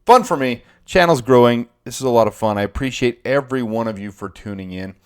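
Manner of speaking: ordinary talk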